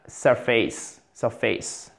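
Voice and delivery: "surface." stressed on SUR, weak ending; first-syllable stress. Shown as a demonstration. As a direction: punch the first syllable of "surface.", let the second drop. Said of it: "Accent tonique sur la première syllabe". (« surface. » accentué sur la première syllabe)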